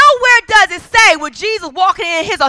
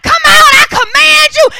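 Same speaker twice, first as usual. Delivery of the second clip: hammed-up exorcist's command, high and loud; mock dramatic shout; 9 semitones higher, 5 dB louder than usual. Preacher mocking dramatic casting out of spirits, overblown shout. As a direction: belt it out in parody of showy deliverance. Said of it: "all of this extra drama".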